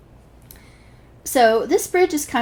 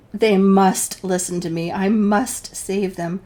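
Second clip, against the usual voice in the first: ordinary talk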